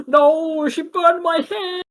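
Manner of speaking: high-pitched, loud, drawn-out wail; screamed wail. Acted. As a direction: wail it loudly in outraged agony. Burned villain wailing while fleeing.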